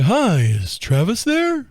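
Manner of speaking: slow, friendly phone greeting; drawn-out hello down the line; voicing Mike asking for Travis; slow caller greeting.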